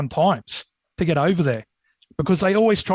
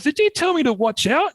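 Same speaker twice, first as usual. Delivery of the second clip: much higher, alarmed question; spooked call to the boys; retold alarm; 11 semitones higher than usual. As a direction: ask the boys in a startled rush. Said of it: "my first thing was to the boys".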